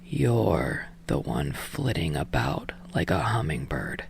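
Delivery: low, slow, whispered monotone; barely bother to speak; deep slow voice. Acted. Tired man answering Yamada, deep slow whisper. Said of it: "in a deep, slow voice".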